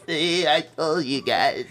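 slow, drawn-out mock whimper; hurt friend. Injured friend reproaching his buddies, mock hurt voice. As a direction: imitate an injured buddy, dragging each word. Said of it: "he looks at us with this".

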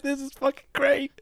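high, laughing delight. Retold or imitated. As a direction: crack up while saying it. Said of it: "you're like".

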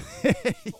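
very high squeal of laughter. Real, not acted. Hearing the razor is decades old, squealing laugh.

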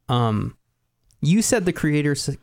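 normal talking voice

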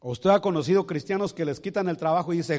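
usual speaking voice

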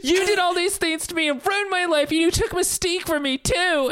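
high, tearful reproach, overplayed; mock anguished accusation. Friend acting Xavier blaming Magneto, hammed-up high tearful voice. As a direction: pound out the hurt in a high wail.

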